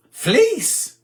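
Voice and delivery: incredulous high question; surprised. Real, not acted. Host reacting to a viewer asking about fleece, incredulous. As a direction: pitch it up in disbelief.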